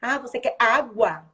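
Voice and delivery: slow, loud, over-articulated question; exaggerated articulation. Shown as a demonstration. Therapist exaggerating mouth movements for a child, slow. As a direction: stretch each sound so the child watches. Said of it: "trabalhar a articulação da criança".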